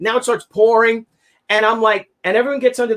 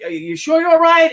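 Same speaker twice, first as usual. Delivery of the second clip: high, louder concerned asking; concerned responder; 7 semitones higher, 4 dB louder than usual. Retold or imitated. Cop checking on injured man, concerned high question. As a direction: check on him with care.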